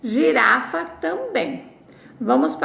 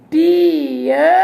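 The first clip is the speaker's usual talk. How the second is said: Piano louder and higher; loud model word; 4 semitones higher, 7 dB louder than usual. Shown as a demonstration. Teacher to class, modelling piano once more. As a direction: call out Piano clearly. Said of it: "as duas vogais se separaram".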